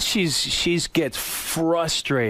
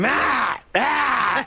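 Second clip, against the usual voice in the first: pained yelps; 10 semitones higher than usual. Retold or imitated. Father crying out while being struck, pained yelps. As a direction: cry out like a man being hit. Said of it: "I hear him go".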